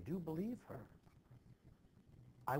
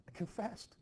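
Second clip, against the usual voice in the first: pleading insistence; 9 semitones higher than usual. Acted. Husband pleads he confessed.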